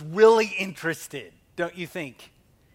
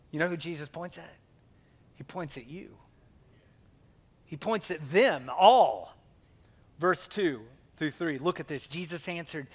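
normal talking voice

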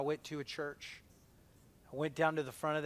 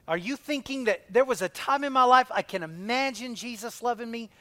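higher, louder rising plea; impassioned preacher's question; fervent; 7 semitones higher, 8 dB louder than usual. Acted. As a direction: build the question with urgency.

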